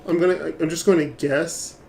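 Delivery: ordinary talk, nothing marked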